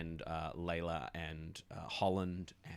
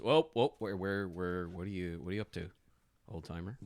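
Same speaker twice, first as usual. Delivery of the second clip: guard; 7 dB louder than usual. Acted. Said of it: "a glaive and says".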